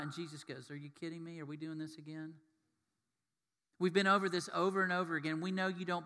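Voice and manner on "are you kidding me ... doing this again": much softer, exasperated aside; mock tired under-the-breath reply; weary disbelief. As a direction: drop the volume, fed up. Jesus' weary reply, acted.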